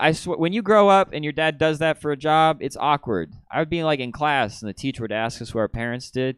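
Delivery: usual speaking voice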